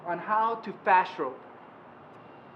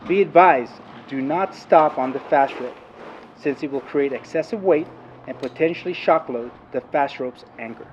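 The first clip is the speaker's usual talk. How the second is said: narration; 5 semitones lower, 10 dB louder than usual. Acted. Narrated training video voiceover.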